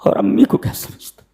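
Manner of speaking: breathy scornful whisper of a rude youth; imitating today's youth in a scornful whisper; mocking whisper. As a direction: hiss out the youngsters' taunt. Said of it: "ये आजकल के नौजवान हैं".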